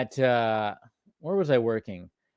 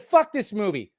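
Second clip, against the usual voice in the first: a touch of mock fury, higher; indignant rant, raised pitch; retold angry rant; 7 semitones higher, 3 dB louder than usual. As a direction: spit it out in disgust. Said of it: "I said".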